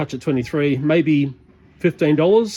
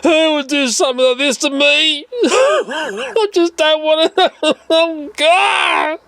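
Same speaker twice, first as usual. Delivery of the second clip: fake crying; 11 semitones higher, slower than usual. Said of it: "start crying".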